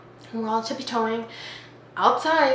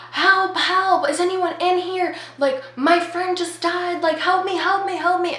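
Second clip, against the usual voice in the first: high-pitched mock cry for help; retold scream for help; 8 semitones higher than usual. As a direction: call out in acted panic. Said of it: "you hear a kid scream".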